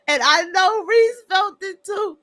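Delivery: words pushed out through laughter; real laughing fit; teary laughter. Real, not acted. Laughing to tears at clip.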